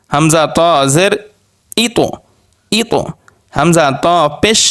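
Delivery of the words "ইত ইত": ইত with the final ত bounced out; qalqalah bounce. Shown as a demonstration. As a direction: jolt the closing ত of ইত. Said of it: "এগুলো একটু ধাক্কা দিয়ে পড়তে হবে".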